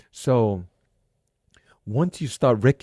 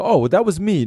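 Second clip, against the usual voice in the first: mock dismissive listener; 7 semitones higher, 7 dB louder, faster than usual.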